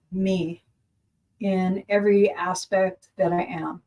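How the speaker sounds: measured, earnest intention-setting; mirror affirmation. Shown as a demonstration. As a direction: declare it to yourself, weighing each phrase. Speaks affirmations to self in mirror.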